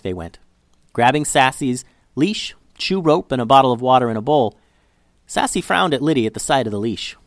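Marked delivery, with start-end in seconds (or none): none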